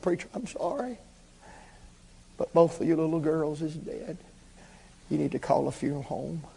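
retold doctor, very soft and subdued; hushed bad news. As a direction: break the sad news gently, almost under your breath. Doctor breaks death news in story.

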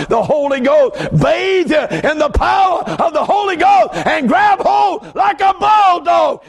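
high, slow shouted preaching; preaching at full force. Real, not acted. Preacher urging the church to hold on. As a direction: build to a holy shout.

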